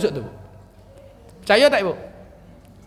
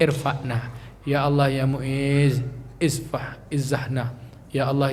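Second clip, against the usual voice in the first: closing prayer; 7 semitones lower, 4 dB quieter than usual. Real, not acted. Teacher closes the study session with a soft prayer. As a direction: pray quietly and reverently to close.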